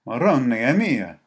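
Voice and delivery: loud, slow astonished cry; exclaiming. A narrator's reading. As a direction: burst out in shocked Italian. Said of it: "exclaimed the lean figure".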